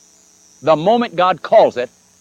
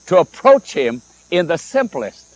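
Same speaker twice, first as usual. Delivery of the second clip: ordinary delivery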